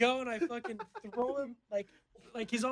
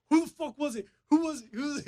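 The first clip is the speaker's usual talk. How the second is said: high, loud imitation of outrage; all mad; 7 semitones higher, 5 dB louder than usual. Friend demanding to know who beat him up, imitated anger. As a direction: fire off the demand like an angry friend. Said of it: "He's, like, all mad".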